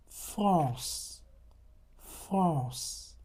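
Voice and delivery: France with a nasal vowel and no n; French nasal example. Shown as a demonstration. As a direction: model France twice, nasal. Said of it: "You have".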